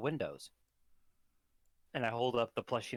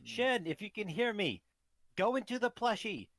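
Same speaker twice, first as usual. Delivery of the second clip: high calling voice; 10 semitones higher, 3 dB louder than usual. Acted. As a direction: call out high into the plushie. Penumbra calls to ghost.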